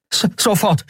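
high-pitched, eager stutter; hasty, stammered reply. A narrator's reading. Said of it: "rannte hastig die Treppe hinauf". (hastig gestammelt, mit hoher Stimme)

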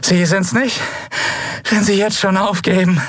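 high, strained, spent voice; worn-out high-pitched defiance; exhausted taunt. A narrator's reading. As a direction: goad the captor with your last strength. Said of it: "Sie grinste erschöpft".

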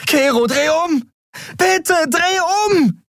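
desperate high-pitched pleading shout; frantic drawn-out plea; screaming. A narrator's reading. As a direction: beg at the top of the voice. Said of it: "schrie sie".

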